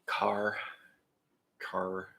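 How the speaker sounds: car said with a soft, barely there R; saying car to show a friend the soft R. Shown as a demonstration. Car with a soft R. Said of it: "Like a soft R instead of a hard R".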